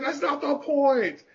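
high, slow, drawn-out comeback; mock angry retort. Shaking the roommate while answering them, mock angry retort. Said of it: "I'm like".

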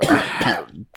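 choking cough, something down the wrong way; sudden hacking cough; coughing fit. Real, not acted. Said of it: "Went down the wrong way".

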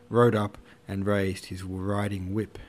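ordinary delivery, nothing marked

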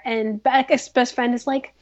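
usual speaking voice

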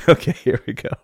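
laughing. Real, not acted. Still laughing after the bit.

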